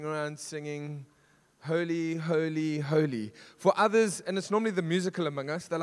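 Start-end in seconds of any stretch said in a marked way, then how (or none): none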